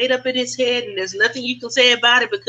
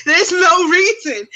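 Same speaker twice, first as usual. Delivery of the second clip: slow, high, laughing admission; words riding on a laugh; 5 semitones higher, 4 dB louder, slower than usual. Real, not acted. Laughing admission of baseless upset.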